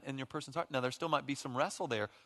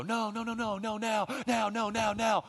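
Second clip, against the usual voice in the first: put-on much higher, insistent, childish no no now; mock whiny protest; 10 semitones higher, 4 dB louder than usual. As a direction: whine like a spouse who won't let go. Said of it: "the worst thing the other person can do is go".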